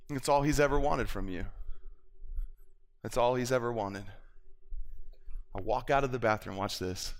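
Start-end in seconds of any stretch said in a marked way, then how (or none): none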